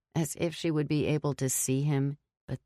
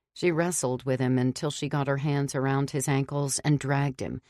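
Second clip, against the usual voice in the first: plain reading voice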